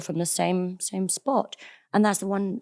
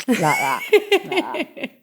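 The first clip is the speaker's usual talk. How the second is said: grandma impression; 8 semitones higher, 4 dB louder, much slower than usual. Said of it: "And Grandma sat there".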